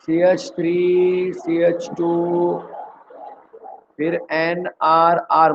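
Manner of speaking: drawn-out formula, spelled out piece by piece; syllable by syllable, very slow; slow dictation. Shown as a demonstration. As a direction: read each part slowly for notes.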